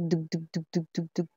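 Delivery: soft thumping heartbeat sounds, said quietly; heartbeat imitation. Shown as a demonstration. Reader imitating a heartbeat for viewers, soft rhythmic thumps. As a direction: tap out a heartbeat softly with your voice. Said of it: "Kumbaga sa heartbeat".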